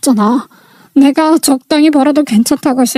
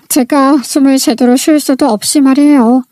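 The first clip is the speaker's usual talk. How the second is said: ordinary delivery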